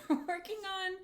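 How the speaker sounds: high, giggling restart; laughing. Real, not acted. Streamer retrying the line, giggling. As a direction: laugh as you say it.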